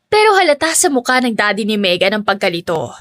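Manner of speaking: ordinary delivery, nothing marked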